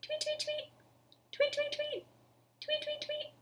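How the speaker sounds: high, light chirping tweets; bird tweets. Acted. Birds greet Happy Lion with high chirps. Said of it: "the bird said".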